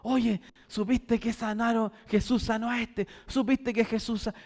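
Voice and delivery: gossiping murmur. Retold or imitated. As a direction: pass the rumour along quietly. People passing news in whispers.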